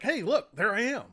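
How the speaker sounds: Randy Johnson pointing at his own plaque, amused; imitating Randy Johnson. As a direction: lightly play the delighted star spotting his own plaque. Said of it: "He's like".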